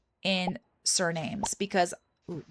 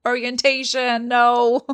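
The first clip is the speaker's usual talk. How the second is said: laughing loud no at the screen; cracking up over church orientation; laughing in disbelief; 3 semitones higher, 9 dB louder, slower than usual. Real, not acted. Laughing to viewers at a priest's dull lore. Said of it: "Oh God, guys".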